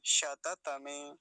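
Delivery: शततमे very slow, all vowels sounded; शततमे as "sha-ta-ta-me"; correct full form. Shown as a demonstration. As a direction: stretch शततमे syllable by syllable. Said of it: "ऐसा बोलेंगे".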